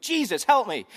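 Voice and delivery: high, loud panicked plea; acted cry for help, frantic; freaking out. Preacher voicing Mary begging her son for help, freaking out. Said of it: "she's freaking out".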